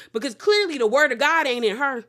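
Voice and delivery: ordinary talk, nothing marked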